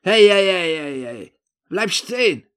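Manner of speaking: loud, high shout after someone; calling out. Acted. Narrator stopping the man as he leaves, calling out. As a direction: shout to halt someone walking off.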